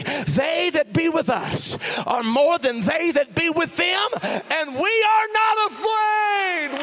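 slow, swelling to a high shout; building to a shout. Acted.

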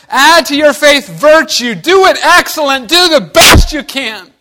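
loud, high, slow pulpit proclamation; preaching. Acted. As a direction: thunder it from the pulpit. Preacher proclaiming God's words.

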